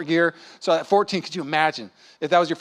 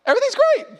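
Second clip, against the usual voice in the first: bright high happy answer, pitched way up; cheery wife reply; faster than usual. Retold or imitated. Husband asks wife, she answers brightly and cheerfully. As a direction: sound genuinely glad everything is great. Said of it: "So if I ask Rachel".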